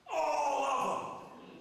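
drawn out and loud, even harder; emphatic shout. Real, not acted. Preacher repeating to the congregation, emphatic shout. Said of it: "I'm gonna be emphatic one more time".

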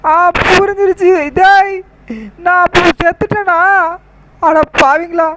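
hammed-up horror at finding out you are dead; shocked realisation. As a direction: gasp it out as the truth hits you.

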